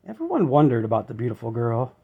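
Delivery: ordinary delivery, nothing marked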